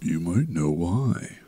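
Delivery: cryptic hint. Real, not acted. Teasing hint about episode release.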